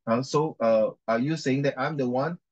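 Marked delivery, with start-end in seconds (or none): none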